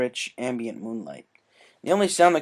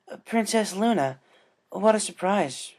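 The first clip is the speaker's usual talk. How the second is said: put-on higher, hesitant young voice; a mild startled greeting, pitched up; Rainbow Dash, surprised; 8 semitones higher than usual. Rainbow surprised by the princess at the lake, higher voice.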